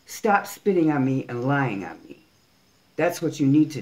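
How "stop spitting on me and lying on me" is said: ordinary talk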